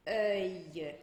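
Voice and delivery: "œil" drawn out into a Y glide; L como I. Shown as a demonstration. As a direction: say "œil" as French "euy", no L. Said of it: "a gente vai dizer". ("œil" em francês, terminando num I curtinho)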